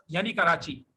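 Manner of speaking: normal talking voice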